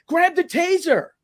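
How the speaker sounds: high, drawn-out called command; retold urgent order. Cop to bystander during a fight, urgent order. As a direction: imitate calling out an order mid-struggle. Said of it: "and he goes".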